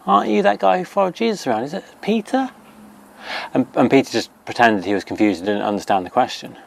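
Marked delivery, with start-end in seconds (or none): 0.1-2.5 high, quick and nosy, a lightly voiced servant girl; a hint of a servant girl catching someone out; curious servant girl. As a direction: pipe up like a girl who just spotted him. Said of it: "saw him and recognised him and said".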